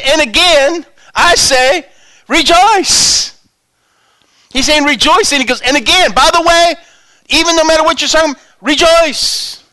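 high, loud proclamation; preacher crying out rejoice; fervent preaching. Real, not acted. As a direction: ring out rejoice to the crowd. Preaching Paul's words loudly.

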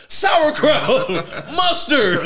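hammed-up hot dog seller shouting; mock stadium vendor. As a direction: hawk food like a ballpark vendor, laughing.

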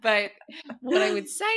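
laughing, cheeky self-plug. Real, not acted. Coach jokingly telling everybody to hire her, laughing.

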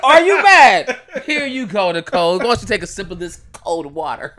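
mocking, laughing taunt. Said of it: "I'm like".

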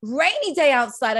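high, lively podcast greeting; excited. Real, not acted. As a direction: greet the listeners with real excitement, pitch jumping up. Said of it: "I am so excited to be here".

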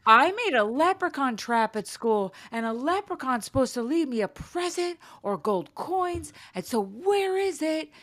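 put-on insistent child. Daughter explaining leprechaun trap, high insistent child voice. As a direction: plead like an entitled kid. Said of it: "She goes".

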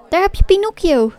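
high, loud puppet cry; excited shout. A narrator's reading. A marionette spots Pinocchio from the stage, shouting. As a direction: shout it out on spotting a friend. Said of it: "schreeuwde de marionet".

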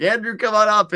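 high, loud call up to a friend. Retold or imitated. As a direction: shout him up the rocks. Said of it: "and I'm like".

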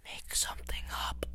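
hushed whisper. Real, not acted. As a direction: whisper it aside. Whispered aside.

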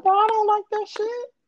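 high, put-on exasperated voice; mock annoyed boyfriend. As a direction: pitch it up like a fed-up guy.

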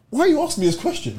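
high-pitched retold protest; exasperated imitation of his own protest; mock indignation. Retold indignation at officer's questions.

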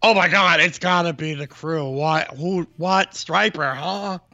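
outraged fans. Retold or imitated. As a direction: act like baffled rock fans. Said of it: "people were like".

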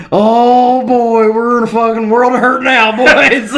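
high, loud, half-laughing dread; storyteller hamming up an oh-no moment; mock alarm. Storyteller recalling his panic at the show, mock alarm.

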